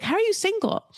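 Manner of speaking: high-pitched imitation of disbelief; mock amazement. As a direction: swoop up high as if astonished. Acts out Kimberly's amazed question.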